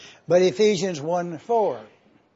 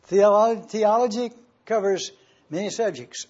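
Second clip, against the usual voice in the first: ordinary talk, nothing marked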